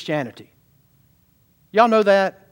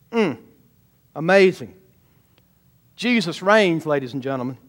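ordinary delivery

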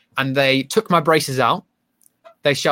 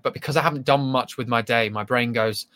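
normal talking voice

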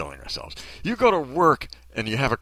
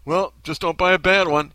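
higher, louder mock-helpful quip; mock glib advice; 6 semitones higher, 7 dB louder than usual. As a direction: toss out useless advice brightly. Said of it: "Are they gonna go".

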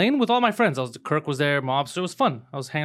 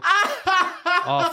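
mock shocked gasp; 13 semitones higher than usual. Hearing he won.

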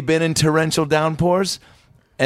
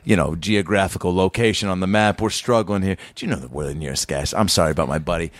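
peacekeeper; 7 semitones lower than usual. Retold or imitated. Driver pleads with toll guy for directions, low and placating. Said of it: "I'm trying to be the peacekeeper".

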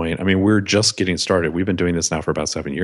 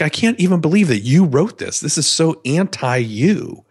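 mock incredulous attorney; 7 semitones higher, 3 dB louder than usual. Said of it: "And he's like".

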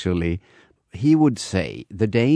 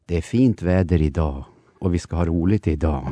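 Swedish, pitched lower and falling; Swedish melody; 3 semitones lower than usual. Shown as a demonstration. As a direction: let the Swedish go down. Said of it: "Swedish, it will be like this".

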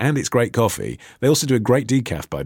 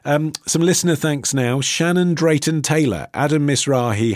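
ordinary talk

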